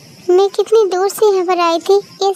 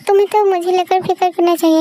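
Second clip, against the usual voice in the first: normal talking voice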